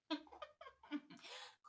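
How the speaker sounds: a very high, mocking cackle. Laughing at the friend who blocked her, high mocking cackle.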